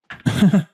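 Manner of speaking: short, high, loud chuckle; laugh. Real, not acted. Host amused by Zelensky remark, real laugh. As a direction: let out a quick high laugh.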